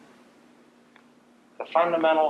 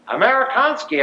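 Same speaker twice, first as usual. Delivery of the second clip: a mock soldier's call, "Amerikansky" higher and louder; 10 semitones higher, 5 dB louder than usual. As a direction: call "Amerikansky" up at him out of the dark. Soldier calls out in dark.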